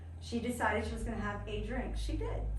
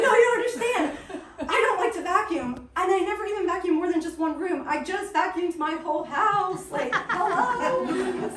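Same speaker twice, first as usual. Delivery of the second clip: high, loud, laughing through the words; laughing insistence; 8 semitones higher, 10 dB louder than usual. Retold or imitated. Paula insisting to the laughing group, laughing loudly. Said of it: "We were dying laughing".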